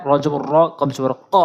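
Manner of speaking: ordinary talk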